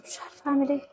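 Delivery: soft, faraway murmur; quiet lost murmur; helpless murmur. Acted. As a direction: say it almost to herself, lost. Helpless, repeating herself.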